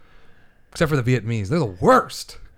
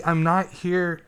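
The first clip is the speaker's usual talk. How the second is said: normal talking voice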